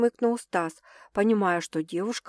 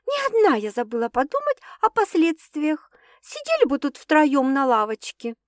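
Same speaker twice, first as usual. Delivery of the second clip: indignant; 6 semitones higher than usual. A narrator's reading. Возмутилась девушка.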